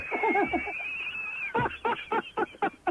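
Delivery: sudden squeaky laugh; real laugh bursting out; host giggling at the magazine name; high-pitched giggle. Real, not acted. Host laughs at editor title.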